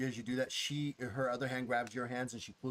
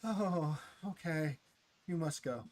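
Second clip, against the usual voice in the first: higher, reluctant sighing voice; sighing reluctance; 5 semitones higher, slower than usual. Acted. Her letting the small one go, reluctant sigh. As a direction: give in with a sigh. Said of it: "you hear that sigh".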